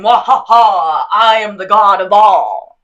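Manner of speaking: slow, loud hammed-up evil villain; mock villain boast. Mocking the idea of being the only god, as a hammy villain. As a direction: ham up a gloating villain. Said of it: "I'm not saying".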